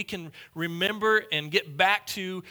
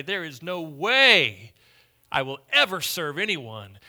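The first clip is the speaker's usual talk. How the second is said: defiant refusal; 7 dB louder than usual. Acted. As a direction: plant your feet and refuse, loudly. Preacher voices a defiant unbeliever.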